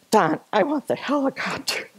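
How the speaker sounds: high, whispery mock whimper; retold tearful plea. Hiker to husband on the trail, mock tearful. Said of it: "I burst into tears and said".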